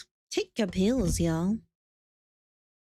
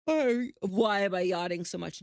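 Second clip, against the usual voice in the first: normal talking voice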